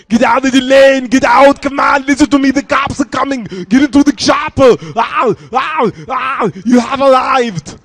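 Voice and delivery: put-on full-volume yelling action hero with thick Austrian accent; Arnold Schwarzenegger. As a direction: yell frantic GPS orders, stretching the last cries. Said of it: "that's just Arnold yelling at you".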